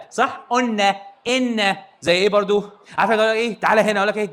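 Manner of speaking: ordinary talk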